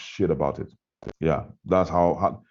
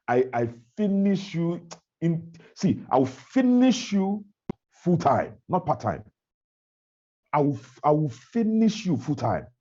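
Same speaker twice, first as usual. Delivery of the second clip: rugged, combative vow; rugged threat; 6 semitones higher than usual. Acted. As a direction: issue a defiant warning, leaning on each word. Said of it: "that's how rugged I am".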